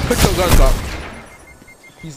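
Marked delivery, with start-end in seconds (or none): 0.1-0.8 shouted callout. Real, not acted. Urgent callout mid fight.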